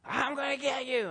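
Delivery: high mock scream; screaming man. Pastor imitating the man yelling at him. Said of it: "he's still screaming".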